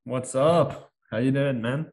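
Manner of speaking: greeting with a hint of London or Jamaican accent; slight unplaced accent. Real, not acted. Co-host greeting the host, slight stray accent. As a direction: say hello with a faint foreign lilt, London or Jamaican. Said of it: "Jamaican accent in there, bro? Like some London?".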